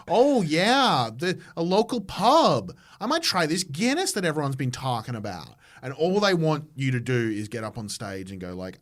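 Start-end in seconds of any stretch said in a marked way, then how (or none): 0.1-5.5 lightly hammed-up American tourist, higher and louder; gushing American tourist keen on Guinness; mock American accent. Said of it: "an American walking around going".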